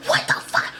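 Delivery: harsh, rasped mock scream; hammed-up furious outburst; imitated screaming rant. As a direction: rasp it out like a man losing his temper. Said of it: "he was screaming at this point".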